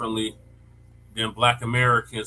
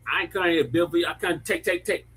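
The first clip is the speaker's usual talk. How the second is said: higher-pitched put-on African accent; 5 semitones higher than usual. Shown as a demonstration. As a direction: do a quick mock African accent, voice lifted. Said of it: "how do you say it in the African accent".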